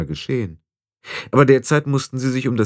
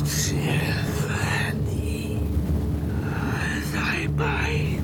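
a slow, breathy call carried on the wind; eerie wind whisper; 5 semitones lower, 6 dB quieter, much slower than usual. Acted. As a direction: ghostly whisper with reverb.

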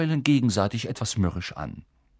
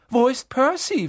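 mother calling; 7 dB louder than usual. A narrator's reading.

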